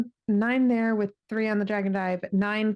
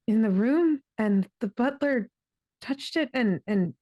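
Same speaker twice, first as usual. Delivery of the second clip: ordinary delivery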